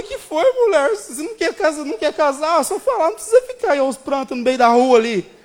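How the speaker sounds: high, loud, flustered questioning; high, loud, panicked plea. Retold or imitated. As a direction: press her anxiously for an answer.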